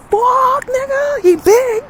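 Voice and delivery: very high, loud comic shriek; panicked yell. Retold or imitated. Parent reacts to son's report, panicked shriek. Terrified parent echoes son.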